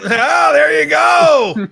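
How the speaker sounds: loud laughing cheer; laughing shout. Real, not acted. Crowd-style laughing outburst.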